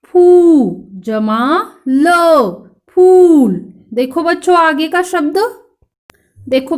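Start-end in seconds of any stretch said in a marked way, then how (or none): 0.0-0.9 फू loud with a long ऊ; pronunciation demo. Shown as a demonstration. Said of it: "का उच्चारण कैसे होगा?". 3.0-3.5 फूल loud, high, ऊ held long; pronunciation demo. Shown as a demonstration. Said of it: "मेरे पीछे पीछे आपको भी करना है".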